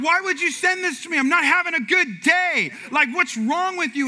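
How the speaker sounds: loud, high mock outrage; hammed-up offended husband; aggrieved rant. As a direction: demand an explanation, dragging out the complaint. Acts out his offended reaction to wife.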